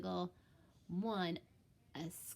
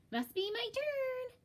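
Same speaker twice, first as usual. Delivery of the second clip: sneaky Clark. Acted. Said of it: "Clark sneaks the toy".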